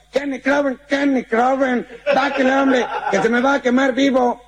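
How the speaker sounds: put-on high Mexican-accented shout up the building; hammed-up Mexican yelling upward; Mexican calling up.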